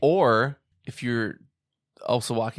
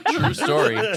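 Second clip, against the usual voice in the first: laughing; 10 semitones higher, 6 dB louder, slower than usual. Real, not acted. Laughing agreement.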